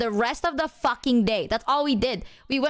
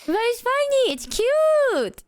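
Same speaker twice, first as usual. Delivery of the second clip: much higher, excited protest; insistent gushing; 13 semitones higher, 3 dB louder, slower than usual. Real, not acted. Friend countering embarrassment about sleep jolts, high squeal.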